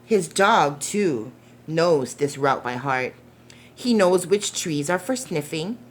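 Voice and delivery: gentle storybook narration, unhurried; reading to children. Acted. Reading The Paper Boy aloud to young listeners, gentle storytelling. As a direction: read it like a bedtime picture book. Reads picture book aloud.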